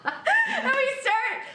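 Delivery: high, drawn-out words through laughter; laughing while talking. Real, not acted. Friend recapping the game, helpless with laughter. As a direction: try to talk through real laughter.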